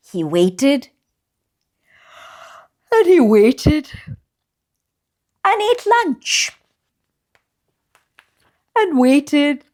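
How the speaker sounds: gentle, lively picture-book read-aloud; reading to children. A narrator's reading. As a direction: read it like bedtime story time for little ones.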